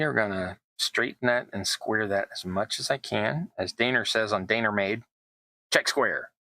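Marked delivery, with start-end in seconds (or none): 5.7-6.3 put-on quick Southern accent on the quote; a passing Southern accent. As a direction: drop into a Southern accent for the catchphrase. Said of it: "that was me with a Southern accent".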